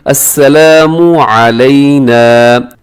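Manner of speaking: slow, drawn-out recitation; measured teaching chant; tajweed recitation. Shown as a demonstration. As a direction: recite slowly with long held vowels. Recites for learners.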